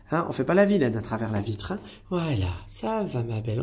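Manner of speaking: ordinary talk, nothing marked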